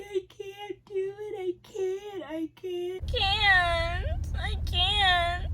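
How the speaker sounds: high, whimpering can't through tears; a touch of sobbing, close to giving up; crying despair. Retold or imitated. To the woman coaching her, mid-push: tearful high whimper.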